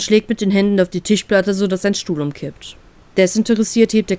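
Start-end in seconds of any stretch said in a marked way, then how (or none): none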